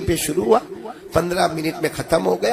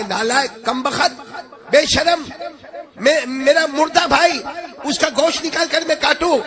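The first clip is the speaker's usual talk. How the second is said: outraged scolding; 10 semitones higher than usual. Retold or imitated. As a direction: scold him, disgusted and furious. Outraged retort to friend.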